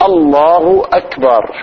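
الله أكبر slow, each hamza sounded; correct takbir. Shown as a demonstration. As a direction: say الله أكبر carefully and slowly. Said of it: "لا". (تكبير صحيح متأن)

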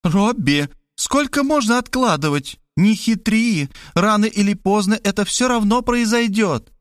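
put-on high, reproachful old mother; mother's nagging voice. A narrator's reading. Mother scolding son at the door, high and reproachful. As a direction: scold him like a fussy mother.

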